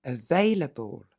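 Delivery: available slowly, weak schwa on the able; slow model word. Shown as a demonstration. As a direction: stretch out available, able unstressed. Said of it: "Encore une fois". (available lentement, able sans accent)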